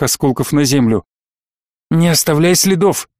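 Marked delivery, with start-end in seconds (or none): 1.9-3.1 sharp raised command; shouting at. A narrator's reading. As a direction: snap it out as an order. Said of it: "прикрикнуть на ездового демона".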